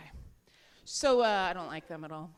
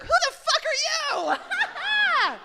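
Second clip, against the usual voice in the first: very high, loud, drawn-out indignant shriek; mock outrage; 7 dB louder, much slower than usual. Woman on the couch asked for a condom, mock outrage. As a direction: shriek it slowly at an intruder. Acted outraged reply to partner.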